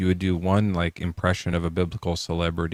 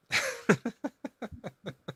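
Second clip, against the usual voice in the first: high-pitched laugh; 12 semitones higher, 9 dB quieter than usual. Real, not acted. Cracks up at the joke.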